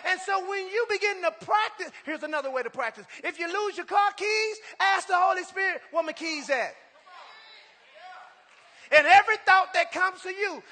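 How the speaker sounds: loud, high, fervent preacher's cry; preaching at full force. Acted. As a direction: lift the church up, shout it out.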